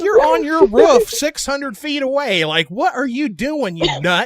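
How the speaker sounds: high, slow incredulous scolding; exasperated. Retold or imitated. Player scolding Walnut on a distant roof, slow exasperation. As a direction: drag out each word in mock exasperation.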